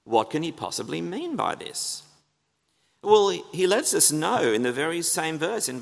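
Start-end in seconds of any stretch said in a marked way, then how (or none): none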